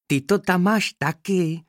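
high little bee snapping back; teasing retort. A narrator's reading. Bee retorts to her classmate, high and cheeky. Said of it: "oplatila jí to včelka".

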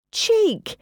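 very high-pitched indignant cry; mock outrage. Gina reacting to the joke about her wrinkles, mock outrage. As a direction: squeal it in affront.

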